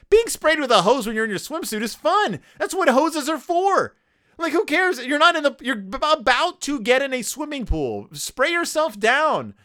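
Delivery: high-pitched comic indignation; exasperated rant. Acted. Host scolds storybook kids upset over a hose, exasperated. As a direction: rant in mock disbelief, pitch climbing.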